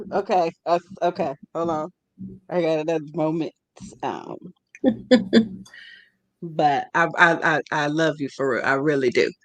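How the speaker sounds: a quiet emotional catch in the voice; holding back tears. Real, not acted. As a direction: near tears but pushing through. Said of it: "I gotta laugh to not cry".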